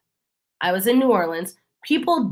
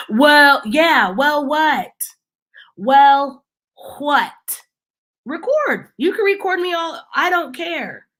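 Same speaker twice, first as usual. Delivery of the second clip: high-pitched sputtering well, what; mock flustered man; 6 semitones higher than usual. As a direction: sputter in a higher voice, cornered. Man flustered after she records.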